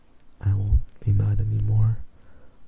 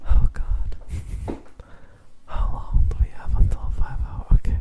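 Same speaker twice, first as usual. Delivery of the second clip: ASMR whisper; 4 semitones higher, slower than usual. Real, not acted.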